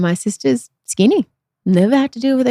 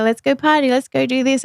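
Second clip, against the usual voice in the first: lightly voiced peppy sister, higher; pushy sister; 6 semitones higher than usual. Sister urging her to party, a higher peppy imitation. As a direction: chirp it like an eager sister. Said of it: "trying to drag me here".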